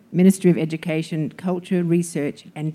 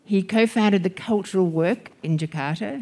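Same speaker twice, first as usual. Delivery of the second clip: normal talking voice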